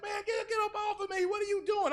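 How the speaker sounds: hammed-up panicked yelling; mock scream. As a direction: yell it like you're being dragged off. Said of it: "I'm screaming".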